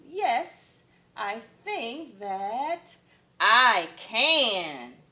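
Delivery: slow drawn think then firm can; slow dawning yes. Acted. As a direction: grow sure as you say it. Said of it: "Kevin replied".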